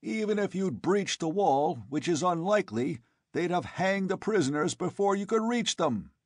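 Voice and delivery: forceful. A narrator's reading. Conan argues with angry mob.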